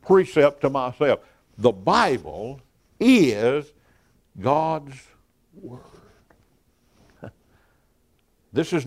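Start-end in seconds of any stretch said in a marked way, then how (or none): 1.6-5.8 very slow, IS stretched and set apart; emphatic IS. Real, not acted. Preacher emphasizes a simple precept to himself, emphatic IS. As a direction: spell it out word by word, leaning hard on IS. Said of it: "I have to really emphasize".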